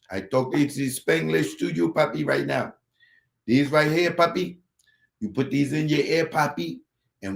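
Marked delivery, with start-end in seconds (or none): none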